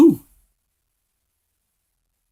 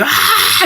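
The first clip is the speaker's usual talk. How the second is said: high-pitched mock scream; imitated scream. Man imitating his masked uncle at a birthday party, mock scream. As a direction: let out a drawn-out "ah" scream, mimicking someone. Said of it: "he was screaming with".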